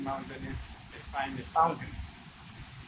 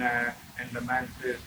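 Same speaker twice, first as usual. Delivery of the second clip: ordinary delivery, nothing marked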